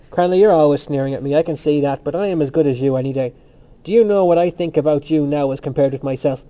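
loud, emphatic challenge; brave defiance. A narrator's reading. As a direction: stand up to the sneerer boldly. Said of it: "Temple turned on him bravely, saying".

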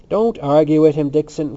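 loud, brusque order; a touch of brutality, hard on the first words. A narrator's reading. Cranley orders Dixon not to argue, brutally. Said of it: "Cranley said brutally".

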